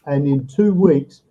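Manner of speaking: ordinary talk, nothing marked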